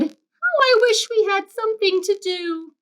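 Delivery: put-on high, longing little-boy voice; wistful boy's voice. Boy wishing on a rainy day, high and wistful. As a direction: sigh it out like a bored child. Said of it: "and I said".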